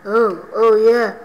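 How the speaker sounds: ordinary talk, nothing marked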